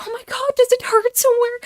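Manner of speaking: very high, rushed panicked squeal; mock panic. Adult fussing over a child who fell, exaggerated panic. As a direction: panic in a high, fast imitation. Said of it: "to not panic the boy by going like".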